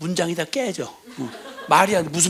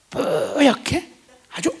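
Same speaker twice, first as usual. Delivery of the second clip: drawn-out cute word; 10 semitones higher, 4 dB louder, much slower than usual. Acted. Savoring baby-cute word.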